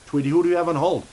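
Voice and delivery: re-ask it in a character voice aimed at Tweety; put-on voice. Host asks Tweety again in voice.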